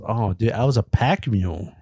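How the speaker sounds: ordinary delivery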